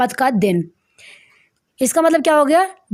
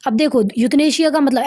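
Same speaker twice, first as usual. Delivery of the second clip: ordinary talk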